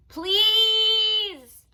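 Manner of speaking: high, loud, sung-out please; begging toddler. Acted. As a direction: drag it out pleading. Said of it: "Fudge begged".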